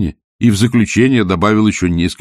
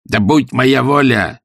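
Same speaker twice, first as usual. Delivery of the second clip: slow, higher indignant outburst; 6 semitones higher, 3 dB louder, slower than usual. A narrator's reading. Scrooge continuing his rant at Christmas, indignant. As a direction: lean indignantly on each word. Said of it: "негодующе продолжал Скрудж".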